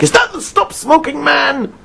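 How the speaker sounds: high, tense angry voice; mock angry caller on the phone. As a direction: snap it like an irate caller. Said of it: "He was incredibly angry".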